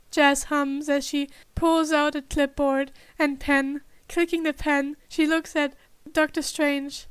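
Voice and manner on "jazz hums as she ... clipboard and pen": plain reading voice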